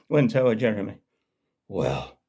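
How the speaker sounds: ordinary talk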